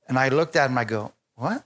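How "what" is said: short, quiet, startled what; a hushed stunned question; quiet disbelief, caught off guard; surprised what. Retold or imitated. Preacher's surprised reply.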